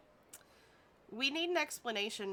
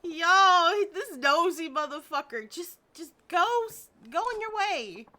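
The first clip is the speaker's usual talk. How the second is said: yelling; 4 semitones higher, 8 dB louder than usual. Acted. Yelling at nosy game character.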